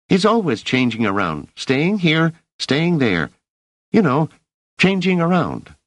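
put-on high boy's voice, cheeky and disappointed; a scrappy street urchin egging her on; street kid. Rache explains Devan.

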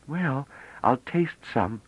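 put-on slow, doubtful girlish voice; hesitant, unsure she wants it; dubious. A narrator's reading. Bliss about Pelorat's food, slow and doubtful. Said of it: "said Bliss dubiously".

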